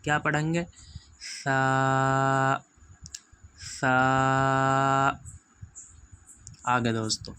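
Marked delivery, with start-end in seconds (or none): 1.4-5.1 "سا" as a long th, tongue lightly out under the teeth; tongue-tip th. Shown as a demonstration. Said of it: "سین نہیں پڑھنا ہے دوستو، سا نہیں کہنا ہے".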